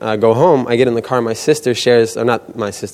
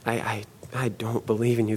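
soft, stammering admission of doubt; faltering confession; 12 dB quieter than usual. Retold or imitated. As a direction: let faith crumble into a quiet, halting admission. Faith falters in retold prayer.

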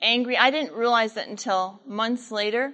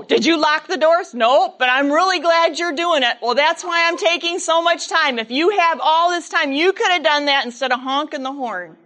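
bickering couple; 5 semitones higher, 5 dB louder than usual. Retold or imitated. Parents bickering.

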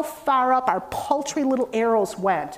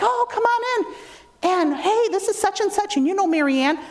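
high-pitched retold welcome; welcoming; 8 semitones higher than usual. Greeters welcome a newcomer at the church door, welcoming.

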